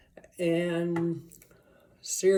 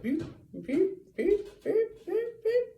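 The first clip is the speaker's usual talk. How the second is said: high, climbing boop sounds, each pair higher; imitated boops. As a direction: bounce the boops upward like a zigzagging thing. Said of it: "And then it went".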